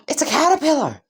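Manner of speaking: high, delighted exclamation; childlike excitement. Acted. Izuku sees Aizawa worm his way in, excited cry. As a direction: squeal it like a thrilled kid.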